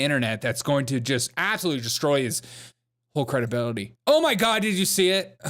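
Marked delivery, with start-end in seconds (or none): 4.1-5.4 high, excited mock freak-out; mock alarm. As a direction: pretend to be scandalised, pitch jumping up. Mock panic over a photo.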